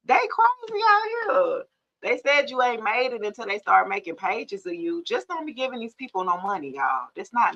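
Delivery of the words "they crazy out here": 1 amused squeal. Real, not acted. Laughing at fake pages.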